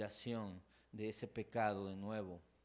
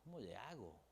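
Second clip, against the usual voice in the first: helpless murmur; 10 dB quieter than usual. Retold or imitated. Quiet voiced helpless question.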